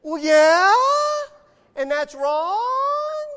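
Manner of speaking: loud, high, comically drawn-out protest; mock indignant outburst. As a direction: wail it loudly, stretching the last word. Mock outburst at wife's accusation.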